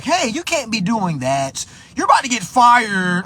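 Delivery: high, loud mock telling-off; scolding coworker. Coworker catching him idle in the break room, mock high scolding.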